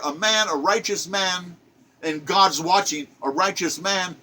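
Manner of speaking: ordinary talk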